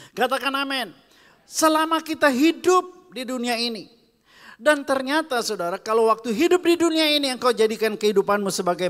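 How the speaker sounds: high, loud preacher's call to the congregation; full-force preaching. Acted. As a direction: preach it out with fire.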